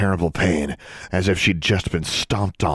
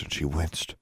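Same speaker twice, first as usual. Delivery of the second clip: ordinary delivery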